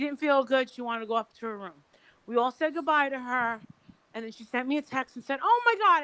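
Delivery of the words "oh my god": high, louder mock alarm; dismayed exclamation. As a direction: read out her text as a mild high-pitched outcry. Retold text message, dismayed exclamation.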